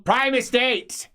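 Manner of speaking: high, loud, slow correction; high, loud, deliberate; correcting sharply. Acted. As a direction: spell out the proper term, annoyed.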